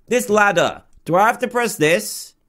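ordinary talk

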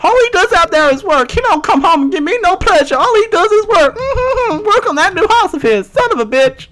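high-pitched ranting housewife impression; sassy nagging woman. Neglected girlfriend complaining that he only works, high sassy nagging. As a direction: rant in a high, put-on woman's voice.

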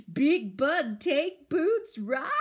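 put-on loud, high, slow little-girl pleading; slow toddler voice. A narrator's reading. Toddler asking the big bug for a ride. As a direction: stretch each word like a hopeful toddler. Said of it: "she said".

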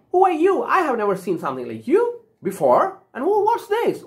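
lightly acted surprise, pitched high; surprised Tin Man. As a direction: jump up high on the questions, puzzled. Tin Man meets strangers in story.

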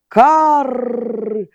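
high raspy crow caw; crow's caw. A narrator's reading. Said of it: "крикнув Ворон".

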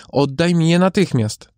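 insist, voice climbing high; demanding cry. A narrator's reading. Mother demanding her child from Death, high urgent cry. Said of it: "zawołała matka".

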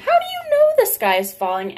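very high, loud goose-like question; doubting Goosey Lucy. Acted. Goosey Lucy questions the birds, very high honk.

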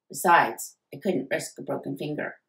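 ordinary delivery

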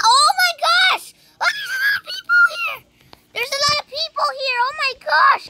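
high, loud squeal of amazement; excited shriek. Real, not acted. Player stumbles on a crowd in the game, excited shriek.

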